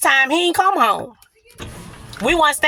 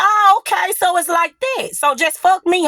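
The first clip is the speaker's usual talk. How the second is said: higher-pitched, affronted retort; mock-agreeing, pitched up in indignation; sarcastic acceptance; 7 semitones higher than usual. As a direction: act out wounded sarcasm. Retold confrontation with partner.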